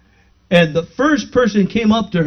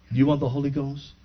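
low, soft, quick question; gentle minister; 5 semitones lower, 9 dB quieter, faster than usual. Retold or imitated. Minister approaching a woman at the altar, retold quietly. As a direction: imitate an understated minister asking quietly.

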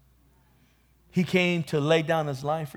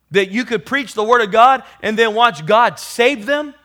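louder and higher with each question; rising preaching; 7 semitones higher, 10 dB louder than usual. Acted. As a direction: build the exhortation to a shout. Rising exhortation to the congregation.

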